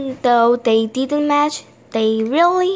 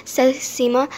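usual speaking voice

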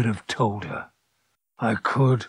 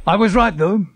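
triumphant; 11 semitones higher, 6 dB louder, faster than usual. Acted. Vindicated inner voice.

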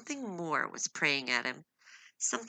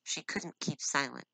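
plain reading voice

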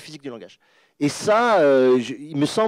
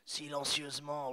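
drawn-out whisper-soft word; 16 dB quieter, slower than usual. Shown as a demonstration. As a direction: stretch the word slowly and very softly. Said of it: "il y a des masses très vastes qui s'étendent".

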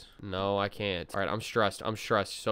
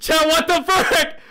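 much higher and louder, slow frightened shriek; scared shriek; 10 dB louder, slower than usual. Real, not acted. Same line repeated, second shrieked.

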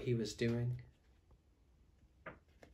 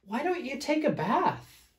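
high-pitched, questioning storybook character; Big Fluffy Bear; 6 dB louder than usual. Acted. Fluffy bear suggesting a bath, high-pitched questioning. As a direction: ask it in a much higher voice. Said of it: "asked Big Fluffy Bear".

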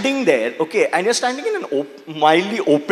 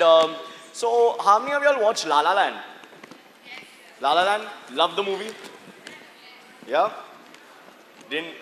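ordinary talk, nothing marked

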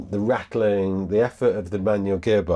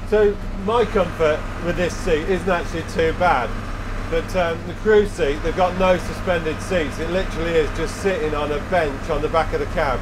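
shouting over engine; 6 semitones higher than usual. Real, not acted. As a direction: project over the noisy cab. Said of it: "I'm having to raise my voice to, um, talk over the engine".

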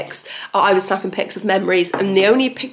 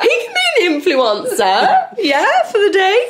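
1 teasing; 11 semitones higher, slower than usual. Real, not acted. Teasing husband, laughing.

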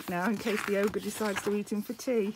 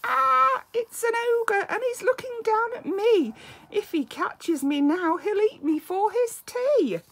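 much higher and louder, frantic; mock panicked cry; 12 semitones higher, 5 dB louder than usual. Little monster seeing the ogre looking down, high panicked cry.

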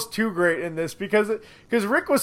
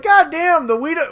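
mock Negan delight; 10 semitones higher, 5 dB louder than usual. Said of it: "he's like".

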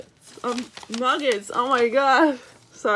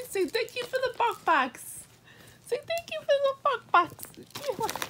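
very high cooing voice; coaxing pet talk; 11 semitones higher than usual. Real, not acted. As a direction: prompt a puppy to say thanks. Coaxing dog to say thanks.

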